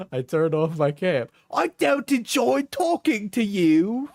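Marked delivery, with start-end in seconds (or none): 1.5-4.1 very high, drawn-out mock Cockney; attempted Cockney. Streamer acting out a partner's insults, mock Cockney. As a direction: stretch it out in a hammed-up, high Cockney. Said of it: "It's Cockney, I think".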